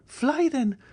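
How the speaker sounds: very high, loud command to flee; frantic high shout to run; urgent cry. A narrator's reading. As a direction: urge him out with a sharp cry. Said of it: "cried Mademoiselle d'Armilly".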